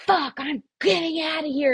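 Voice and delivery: cursing like a banshee. Retold or imitated. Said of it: "just like".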